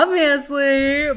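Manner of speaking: slow, self-evident exclamation; emphatic duh tone. Retold or imitated. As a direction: stretch it out as if it's a silly question. Retelling her obvious answer.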